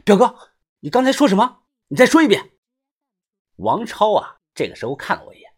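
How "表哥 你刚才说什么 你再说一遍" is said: 音调拔高的急问; 不敢相信地逼问; 急切追问. A narrator's reading. 惊怒追问表哥. (急切地追问表哥)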